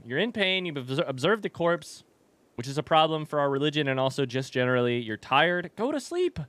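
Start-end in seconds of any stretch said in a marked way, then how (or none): 5.8-6.4 high coaxing voice to a sleepy pet; high sing-song lullaby voice; cooing baby talk. Pretend. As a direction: coo it like tucking in a child. Cooing at Sims character.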